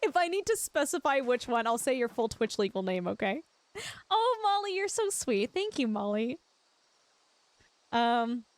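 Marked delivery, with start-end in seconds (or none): 4.1-6.4 high, gushing thank-you. Real, not acted. Thanking Molly in chat, gushing and high. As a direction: coo over her kindness.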